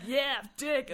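loud, laughing mock brag; cheeky boast. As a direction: toss it out like a cocky joke. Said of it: "I'm always like".

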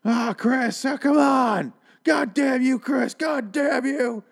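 high, strained wail of his son's name; hammed-up high anguished yell; anguished father's cry. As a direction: cry it out high and despairing, the last words to your kid. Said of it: "Travis starts going like".

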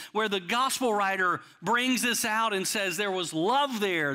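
ordinary talk

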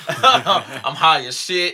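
slow, drawn out through laughter; laughing. Real, not acted. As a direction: stretch it out laughing. Laughing admission about partying.

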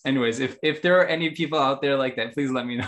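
ordinary talk

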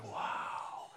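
hushed, breathy wow of wonder; imitating wide-eyed awe; awed. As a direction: let out a soft, amazed wow. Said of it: "you can see the awe".

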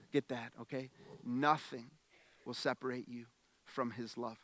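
soft pastoral hush; hushed reassurance. Acted. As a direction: drop low and promise it gently. Drops to hushed preaching.

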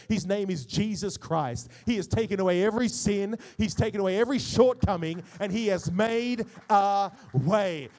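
building to a loud, ringing proclamation; full-force preaching. Real, not acted. Pastor proclaiming Jesus as the sacrifice, building to full-force preaching.

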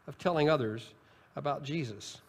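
ordinary delivery

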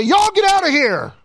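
hammed-up furious king shouting them out; Pharaoh telling them to leave. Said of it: "He's gonna say".

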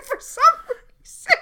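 overwhelmed by absurdity; real exasperated laughing wail; laugh-crying. Real, not acted.

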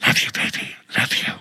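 hushed whisper, unvoiced; whispering on the phone. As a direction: whisper it like a late-night call. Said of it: "I'd hear him going".

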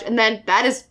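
ordinary delivery

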